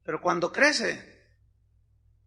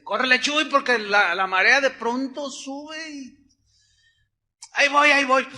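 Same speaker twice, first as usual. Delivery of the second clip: urging to hurry; 5 semitones higher, 5 dB louder than usual. Retold or imitated. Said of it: "me dice Javier".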